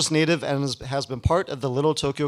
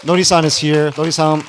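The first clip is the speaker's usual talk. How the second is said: calling out; 4 semitones higher, 9 dB louder than usual. Real, not acted. Calling out to honored guest.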